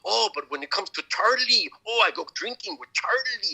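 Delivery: high, mocking Mexican dad, building to a wail; hammed-up father parroting his son, pitch climbing; imitated ranting father. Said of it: "does the impression of his father".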